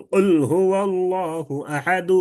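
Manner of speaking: slow chant with a light final u on "আহাদু"; drawn-out recitation, "আহাদু" closing on a brief faint vowel; rawm recitation. Shown as a demonstration. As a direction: chant it at length and only lightly sound the "du" of "আহাদু". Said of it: "হালকা উচ্চারণ করতে হবে".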